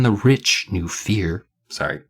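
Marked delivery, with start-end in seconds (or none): none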